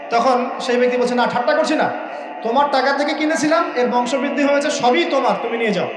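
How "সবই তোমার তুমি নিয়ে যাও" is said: ordinary talk, nothing marked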